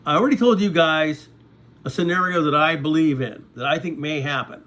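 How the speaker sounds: normal talking voice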